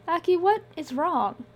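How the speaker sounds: high, gentle woman asking; concerned woman's voice. Acted. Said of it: "Ely said soothingly".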